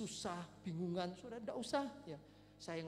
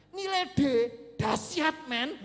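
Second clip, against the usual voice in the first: loud, high mock praise; comic enthusiasm; 6 semitones higher, 12 dB louder, slower than usual. Real, not acted. Preacher cheers a middling grade, loud comic enthusiasm. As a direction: proclaim it as if splendid.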